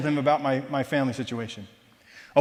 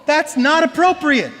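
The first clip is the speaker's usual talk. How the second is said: scolding lecture; 9 semitones higher, 6 dB louder than usual. Retold or imitated. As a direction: give him a piece of your mind, much higher and louder. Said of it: "give him a piece of my mind".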